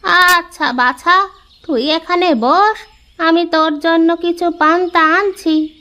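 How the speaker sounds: put-on kindly old granny offering food; old woman. Old woman tells the boy she'll fetch food, lightly kindly.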